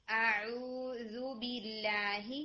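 اعوذ with a relaxed, open ain, very slow. Shown as a demonstration. Teacher showing a relaxed ain in اعوذ. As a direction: let the ain of اعوذ come out loose and slow. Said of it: "عین کو relax رکھیں گے".

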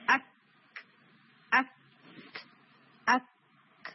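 اک with a hard stop, tiny pause, then a puff of air; اک closed firmly, then released on breath; sakin kaaf demo. Shown as a demonstration. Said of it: "یوں کر کے".

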